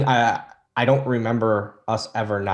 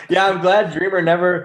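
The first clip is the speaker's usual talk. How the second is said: laughing through the words, higher and louder; 9 semitones higher, 7 dB louder than usual. Real, not acted. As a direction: laugh while you say it. Laughing at host's joke.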